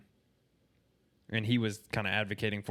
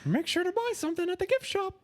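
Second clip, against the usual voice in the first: much higher mock Mickey plug for the gift shop. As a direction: sell it like a greedy cartoon mascot. Parody Mickey selling merchandise.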